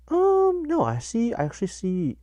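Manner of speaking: hammed-up high, loud reader voice; mock palm reader. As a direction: act out a psychic peering at a vision. Voicing the palm reader correcting himself.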